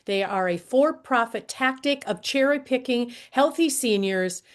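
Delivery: ordinary talk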